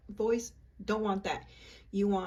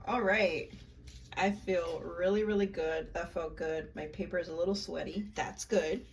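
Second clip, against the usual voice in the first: ordinary talk, nothing marked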